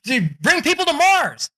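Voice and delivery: high-pitched, fired-up declaration; Elon Musk, fired up. Retold or imitated. Entrepreneur declares a wild plan to everyone, fired up. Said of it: "Elon Musk got all fired up".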